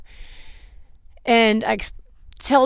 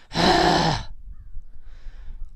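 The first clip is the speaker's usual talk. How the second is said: long, put-upon exhale; exasperated sigh; 5 semitones lower, 3 dB quieter than usual. Retold or imitated. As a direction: sigh as an annoyed clerk would. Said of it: "being all mad and frustrated with me".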